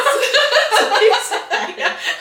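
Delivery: long high burst of laughter; loud laughter. Real, not acted. Laughing at a friend who misheard, long laugh. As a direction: let the laugh run out.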